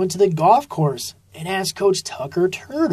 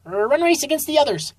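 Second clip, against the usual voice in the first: high, fast rabbit voice; high coach ordering a race; 10 semitones higher, much faster than usual. A narrator's reading. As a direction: rattle it off high and quick.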